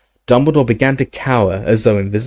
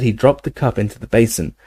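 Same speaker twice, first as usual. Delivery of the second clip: usual speaking voice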